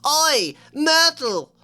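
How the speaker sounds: drawn-out loud high call; bellowing. Acted. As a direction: bellow her name across the dungeon.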